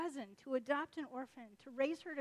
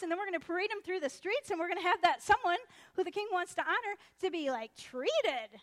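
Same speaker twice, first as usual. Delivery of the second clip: loud, eager pitch with raised voice; playing it up; 6 semitones higher, 9 dB louder than usual. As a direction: lay it on thick, gushing over your own reward. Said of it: "he's really gonna play it up".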